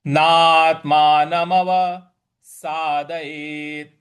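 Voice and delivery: नात्मानमवसादयेत् slow, त after ना hit hard and loud; stress on त. Shown as a demonstration. Teacher demonstrating the stressed syllable, slow and loud. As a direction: punch the त in नात्मानमवसादयेत्. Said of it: "यहाँ पे भी हम आघात देंगे".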